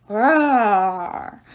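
loud, drawn-out roar; monster roar. A narrator's reading. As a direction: roar like an angry giant. The giant roars in reply.

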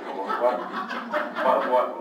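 laughing through a stammered what; laughing disbelief. Retold or imitated. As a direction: laugh it out, baffled.

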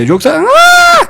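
very high, drawn-out cartoon shriek; stretched squeal; slow shrieked yelp. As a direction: act out the pain. Demonstrating the pain.

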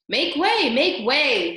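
loud, high call to clear a path; self-important shouted announcement; shouting. A narrator's reading. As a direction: call out over a crowd. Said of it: "shouted Furlow".

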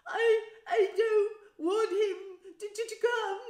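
high, slow, loud fake bawling; pretend sobbing yell. A narrator's reading. Spoilt boy manipulating his mother, fake-wailing. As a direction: stammer it through huge put-on sobs. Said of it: "yelled between huge pretend sobs".

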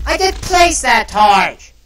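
very loud, slow angry yell; frustrated shout. Real, not acted. Firecracker ruins his build.

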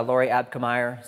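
ordinary talk

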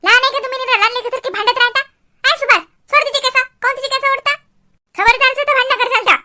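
put-on mother yelling at kids pulling hair; scolding mother. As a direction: shout at the children to stop fighting.

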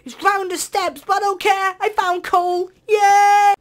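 high, loud, slow triumphant cry; real delighted yell; overjoyed shout. Real, not acted. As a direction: celebrate like a treasure find. Finally finds coal.

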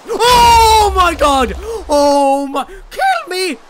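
slow, high, loud cry of shock; startled shout. Real, not acted. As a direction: yell it out, drawn out in fright. Mob suddenly appears in face.

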